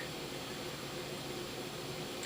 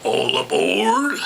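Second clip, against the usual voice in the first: high conductor call; 7 semitones higher, 7 dB louder, slower than usual. Acted. Train conductor calling out.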